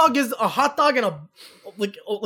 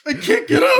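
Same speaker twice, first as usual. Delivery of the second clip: much higher, squeaky helpless voice; hammed-up stuck-on-the-toilet whine; mock helpless; 13 semitones higher, 3 dB louder than usual. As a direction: play someone glued to the seat, pleading. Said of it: "You're like".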